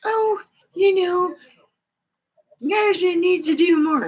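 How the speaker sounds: high, mocking whiny coworker; mock the complainer up high. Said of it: "those are the same people that'll say".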